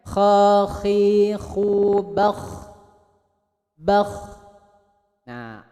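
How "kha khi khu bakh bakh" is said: "Kha, khi, khu, bakh" with a moist throaty kh; relaxed throat, no force; soft wet rasp. Shown as a demonstration. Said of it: "empuk ya dengarnya". (ngorok basah yang empuk)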